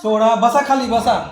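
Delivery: ordinary talk, nothing marked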